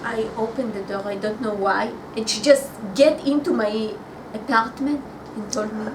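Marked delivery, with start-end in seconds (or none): none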